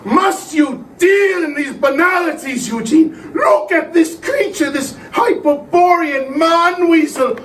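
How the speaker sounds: high, impassioned exclamation; exasperated rapture. Acted. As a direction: rebuke him, then marvel in awe. Mystic rebukes sceptic, marvels at creature.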